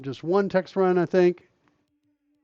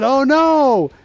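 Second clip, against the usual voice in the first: mock dismay; 9 semitones higher, 6 dB louder than usual. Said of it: "And I said".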